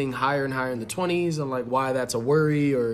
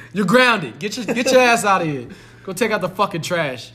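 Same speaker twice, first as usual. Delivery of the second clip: loud, high mock scolding; angry dad; 5 semitones higher, 6 dB louder than usual. Playing beaten dad punishing his son, loud mock anger. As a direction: order the kid about as a sore loser. Acting the beaten dad's revenge.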